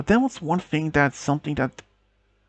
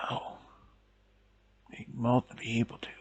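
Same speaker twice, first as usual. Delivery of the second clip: weak whisper; 3 semitones lower, 11 dB quieter than usual. A narrator's reading. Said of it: "words didn't come out strong enough".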